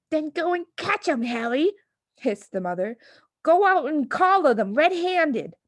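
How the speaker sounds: high, loud urgent hiss; high urgent hissing wife; hissed urging. Acted. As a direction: push him to go catch them.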